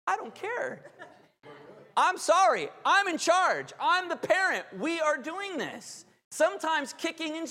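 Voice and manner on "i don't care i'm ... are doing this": higher, louder mock-stern parent, laughing at first; firm parent. Parent telling whining kid who's in charge, mock stern. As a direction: lay down the law, half joking. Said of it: "what do you tell them".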